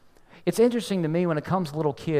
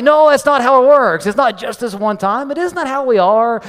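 much louder, higher insistent no; firm correction; 6 semitones higher, 9 dB louder than usual. Retold or imitated. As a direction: put your foot down with the kid. Father firmly corrects son.

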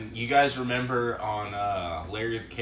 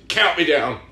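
louder, bossy demand; mock command; 6 semitones higher, 10 dB louder than usual. Retelling a comedy scene, a louder mock command. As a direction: call it out like an order. Retelling a movie character's lines.

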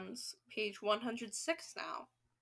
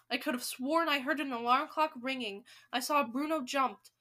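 plain reading voice

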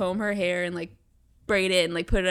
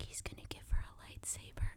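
whispering; 15 dB quieter than usual. Real, not acted. Whispered aside, unvoiced.

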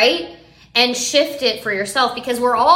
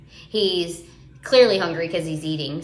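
ordinary talk

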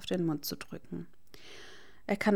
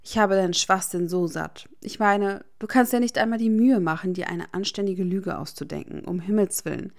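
louder, heated tirade at his friend; angry rant; 7 dB louder than usual. A narrator's reading. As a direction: let the anger pour out. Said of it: "der sichtlich wütend ist". (wütende, laute Schimpftirade)

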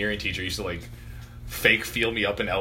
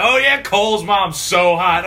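higher, louder, slow mock leer; laughing imitation of a joking teacher; teasing teacher imitation; 6 semitones higher, 6 dB louder, slower than usual. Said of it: "He'd be like".

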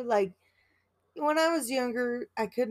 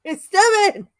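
much higher and louder; loud exasperated; 12 semitones higher, 13 dB louder than usual. Real, not acted. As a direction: blurt it out defensively. Loud high yawn complaint.

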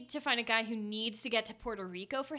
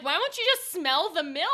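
raised, incredulous question; exasperated; 6 semitones higher, 8 dB louder than usual. Retold or imitated. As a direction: ask in exasperation. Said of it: "And I'm like".

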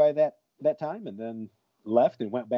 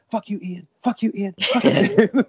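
rising higher and louder each time; retold outburst at a friend, climbing higher each repeat; escalating yell; 9 semitones higher, 4 dB louder than usual. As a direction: build from a laughing gripe to a high shout. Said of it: "the whole time I was just going".

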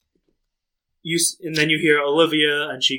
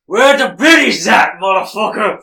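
higher, louder rallying yell; rowdy drunken shout; 5 semitones higher, 8 dB louder than usual. Acted. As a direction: holler it like a roused drunk. Drunk character roused, calls out.

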